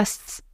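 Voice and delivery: "s-" as a hissed S, a wrong substitute for TH; isolated hissed "s-" sound; S instead of TH. Shown as a demonstration. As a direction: demonstrate a plain sibilant on "s-". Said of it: "c'est pas ça".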